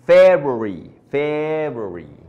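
FEBRUARY as "FEB-u-ree"; February drawn out from a strong first syllable. Shown as a demonstration. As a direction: hit FEB hard in "February", short ending. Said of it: "siempre la pronunciaron como February".

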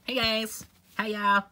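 high, loud goofy greeting; silly character voice. Acted. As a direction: chirp a big hello to the guys.

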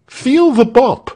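high, loud aggrieved voice; indignant complaint. A narrator's reading. Tell shows the soldier his bump, indignant high voice. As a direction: protest the injury, pitched high.

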